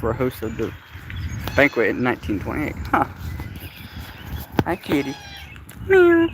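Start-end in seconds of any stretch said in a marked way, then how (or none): 5.9-6.3 loud squeaky imitated meow; playful meow; high meow. Meowing at the cat.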